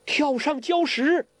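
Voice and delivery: high, drawn-out call; high shout. Acted. Huang Rong to companions on the sinking boat, high shout. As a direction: shout a slow, high order to jump. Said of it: "黄蓉高叫".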